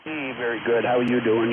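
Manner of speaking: ordinary delivery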